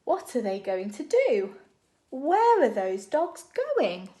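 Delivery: ordinary talk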